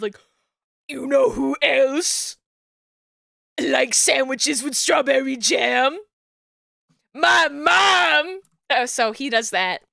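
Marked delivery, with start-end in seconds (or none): 0.9-8.4 Muscle Man quote. Retold or imitated. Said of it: "asking the question of".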